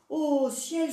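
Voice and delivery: high, loud outcry of horror; a mother horrified by toads, crying out loud; shocked cry. A narrator's reading. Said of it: "s'écria la mère".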